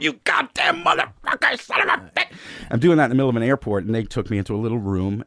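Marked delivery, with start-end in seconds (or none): 0.0-2.3 high-pitched raging outburst; Joe Pesci. Retold or imitated. Comic retelling his airport meltdown on the phone, high Pesci rage. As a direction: imitate an unhinged phone-banging tirade. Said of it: "like, Joe Pesci and J F K".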